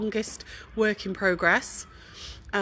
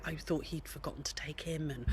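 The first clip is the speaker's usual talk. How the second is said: whispered aside; 4 semitones lower, 10 dB quieter than usual. Real, not acted. Confessing aside to viewers.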